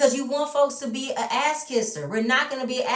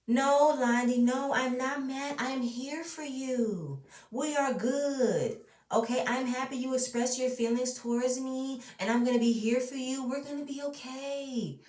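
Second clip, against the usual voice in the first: mock sweet Portia; 4 semitones higher, 3 dB quieter than usual. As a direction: coo it with fake sweetness. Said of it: "she wants to say this".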